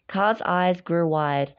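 plain reading voice

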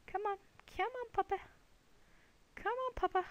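high-pitched pet-calling voice; playful coaxing. Pretend. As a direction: coax it over like a pet. Calling a spider over like a pet.

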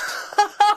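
a gleeful laugh pitched far up; high excited laugh. A narrator's reading. Lloyd dancing around the room, a high gleeful laugh. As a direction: burst out laughing with joy. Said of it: "danced around the room and laughed".

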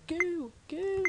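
high silly goo calls; mock goo cries. Imagined stranger yelling goo at someone, silly. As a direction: shout goo like a weirdo. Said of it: "somebody just came up to you going".

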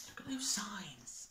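soft secret whisper. Real, not acted. Reader whispers an aside to the child.

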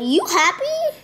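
high squealy mock delight; lightly voiced gushing winner; mock excited. Mimicking a thrilled player, mock delight. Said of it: "I'm like".

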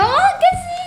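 high, loud startled exclamation; startled cry. Real, not acted. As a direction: jump and cry out. Startled by the cook.

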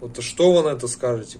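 normal talking voice

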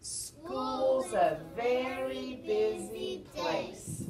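slow, drawn-out group chant; big, stretched-out closing line; everyone's recital line. Shown as a demonstration. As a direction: call it out slowly for all to join.

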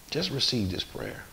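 usual speaking voice

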